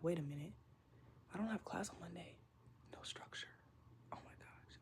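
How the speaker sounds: soft, sinking dawning worry acted out; mock late-night dread, very quiet; hushed realization. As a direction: trail down to a near-whisper as it dawns. Acting out late-night realization in bed.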